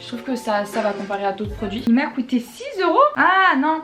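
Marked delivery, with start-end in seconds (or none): 1.9-3.8 high questioning surprise; puzzled double take at the price; surprised doubt. Real, not acted. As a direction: break off with a loud, high "ah non". Doubts the price she just read.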